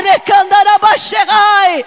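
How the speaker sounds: drawn-out loud tongues; slow ecstatic glossolalia; slow shouted tongues. Acted. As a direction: stretch the tongues out slowly at full voice. Loud tongues during preaching.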